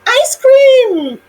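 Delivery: high, excited cry; excited shout. A narrator's reading. Willy Willy shouting for ice cream, high and excited. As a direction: burst out with joy. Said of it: "Willy Willy shouted".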